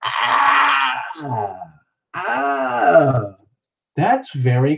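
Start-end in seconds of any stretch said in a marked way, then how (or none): 0.0-3.3 sharp gasp, then high cried "ah, ah"; mock gasp and scream. Host imitating a chat emoji's scream, high mock wail. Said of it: "that one icon that goes".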